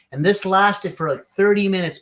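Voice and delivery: ordinary talk, nothing marked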